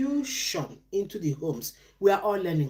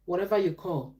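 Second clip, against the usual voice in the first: normal talking voice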